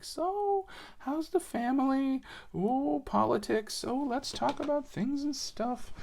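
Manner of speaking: silly small-talk voice. Retold or imitated. Building Lego with a friend, high silly chit-chat. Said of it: "we're just like".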